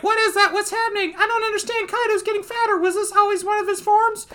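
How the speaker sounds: high-pitched panicky bewilderment; baffled onlookers. Acted. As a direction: squeal it in a cartoonish fluster. Acting onlookers baffled by Kaido.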